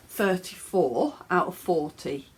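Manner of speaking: ordinary talk, nothing marked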